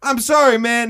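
mock holler of regret; calling down apology. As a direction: call sorry across a distance.

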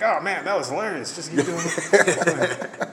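delighted comic. Retold or imitated. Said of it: "He's like".

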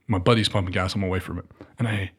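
ordinary talk

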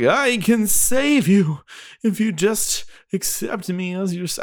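slow, high, drawn-out cult leader preach; Bray Wyatt style. Retold or imitated. Podcaster hamming up a wrestler's mic promo, slow and high. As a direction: stretch each word out like a creepy cult leader. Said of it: "oh, I'm starting to sound like Bray Wyatt".

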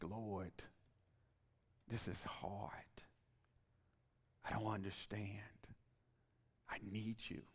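soft, half-whispered plea to God; hushed prayer. Retold or imitated. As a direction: pray it quietly, almost under your breath. Retold prayer in hard moment.

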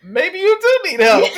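loud, high mock alarm breaking into laughter; alarmed, laughing. Neighbor changing her mind about the girl, laughing mock alarm. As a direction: exclaim it while cracking up.

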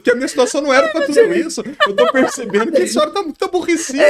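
high, loud, laughing parody of a fussy helper; mocking overdone helper. Joking about overreacting helpers, loud laughing parody. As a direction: ham up a flustered consoler through laughter. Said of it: "Vai chegar outra pessoa e falar assim".

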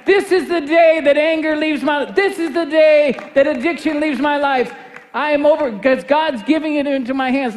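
high, driving declaration; rally the congregation with soaring, insistent fervour; full-force preaching. Real, not acted.

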